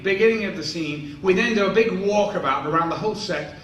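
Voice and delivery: usual speaking voice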